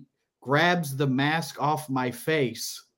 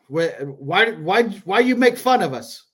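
higher, louder imitation in a rough Middle Eastern accent; Middle Eastern-sounding accent; 5 semitones higher, 5 dB louder than usual. Italian guy confronts comic after the show, imitated in a Middle Eastern-sounding accent. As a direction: put on an approximate Middle Eastern accent, protesting. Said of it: "when I do his accent, it's gonna sound Middle Eastern".

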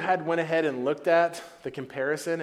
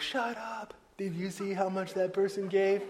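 higher, stunned "Shut up" between friends; amazed disbelief; 6 semitones higher, 3 dB quieter than usual. Retold or imitated. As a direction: gossip in playful disbelief. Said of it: "the disciples were like".

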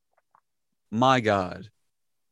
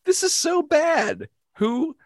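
much higher, slow disbelieving wail; exasperated; 3 dB louder, slower than usual. Retold or imitated. Complaining about a bad accent in a high exasperated wail. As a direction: groan in disbelief at the screen.

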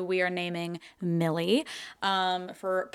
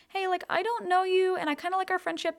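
high, light, placating voice; sweetly pitched-up let-him-down-gently; careful letdown; 10 semitones higher, 3 dB quieter than usual. Retold or imitated. Girl texting a boy to keep things as friends, careful and placating. Voicing her careful rejection text.

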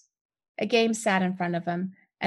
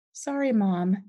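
ordinary delivery, nothing marked

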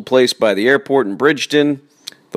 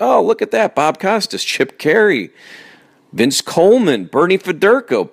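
imitated excited listener; 8 semitones higher than usual. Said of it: "you're like".

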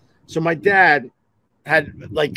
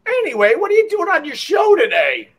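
dismissive shout; 14 semitones higher than usual. Retold or imitated. Boss brushes off the call.

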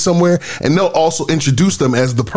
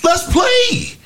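high, eager call to get in the game; rallying cry; 12 semitones higher than usual. Acted. Host rallying listeners, high excited call to play. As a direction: rouse the crowd: let's play.

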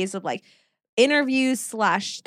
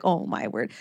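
softer, whispered "Oh my word"; whispered dismay; 6 semitones lower, 7 dB quieter than usual. Retold or imitated. Host retelling Elsie's dismay as she flees, whispered aside. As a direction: breathe it under the breath.

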